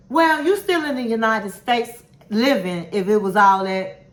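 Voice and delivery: normal talking voice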